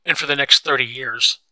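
loud exclamation, carrying on the outburst; loud, exclaimed complaint; crying out. A narrator's reading. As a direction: push it out loudly, question after question. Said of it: "Daisy cried".

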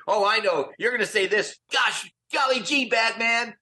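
high, shouted Robin catchphrase; fans quoting Robin. Retold or imitated. Fans in line act out Robin's lines, high excited shout. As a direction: yell it like an eager fan doing Robin. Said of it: "they'd start doing our lines".

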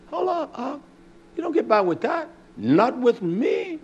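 high, indignant pushback; a lightly voiced indignant rebuke; mock confrontation. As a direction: challenge him like a brother in church. Acted confrontation.

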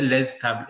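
"les tables" with Z sounded after "les"; Z before consonant. Shown as a demonstration. Teacher showing old French, "les tables" with audible Z before T. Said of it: "prononcer ce z final".

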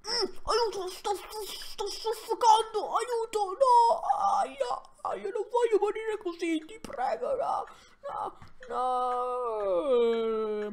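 high, hammed-up panic, pleading for help; mock suffocating plea. Pretending his character is suffocating, hammed-up high pleading for help. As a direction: play-act choking to death, half laughing.

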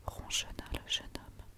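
usual speaking voice

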